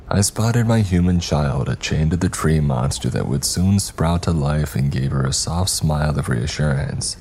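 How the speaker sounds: plain reading voice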